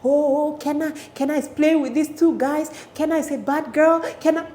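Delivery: high sing-song teasing; mocking classmates. Classmates teasing Kenna over two boys, mocking. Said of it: "making a mockery of it".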